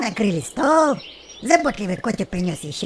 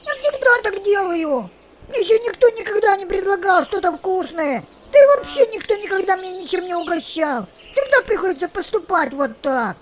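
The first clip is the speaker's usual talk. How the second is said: much higher, chirpy little bird voice; chirping sparrow; 8 semitones higher than usual. A narrator's reading. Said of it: "А воробей прочирикал".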